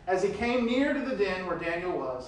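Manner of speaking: ordinary talk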